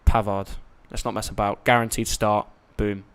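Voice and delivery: normal talking voice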